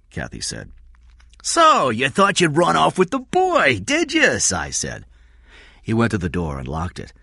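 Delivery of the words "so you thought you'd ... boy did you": high, loud, drawn-out accusation; menacing Cy. Acted. Cy accuses Kathy, menacing. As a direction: taunt them slowly at full voice.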